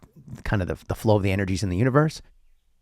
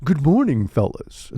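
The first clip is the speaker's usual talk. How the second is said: higher, hammed-up scientist greeting; Neil deGrasse Tyson; 3 semitones higher than usual. Scientist greets the hosts, grand impression. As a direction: greet them grandly, dropping away at the end. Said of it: "Yes, Neil?".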